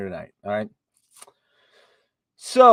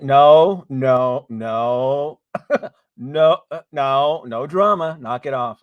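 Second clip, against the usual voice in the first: loud, hammed-up scolding no's; mock stern no; 2 semitones higher, 8 dB louder, slower than usual. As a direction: laughingly shout them down. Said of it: "I said".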